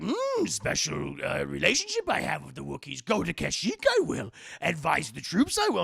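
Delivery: a light Yoda impression. Yoda lightly voiced offering to go to Kashyyyk. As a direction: hum it out like the little green master, lightly. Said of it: "And Yoda's like".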